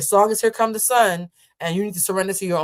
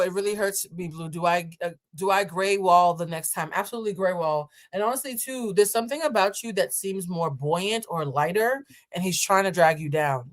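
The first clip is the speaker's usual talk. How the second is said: ordinary talk, nothing marked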